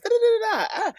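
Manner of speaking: high, sing-song scatting; tossing off a silly high riff between reads; goofy high scat, lightly done; playful voice. Shown as a demonstration. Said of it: "can we get a read in that voice".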